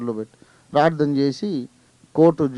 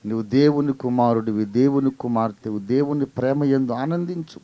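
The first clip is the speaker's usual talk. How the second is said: usual speaking voice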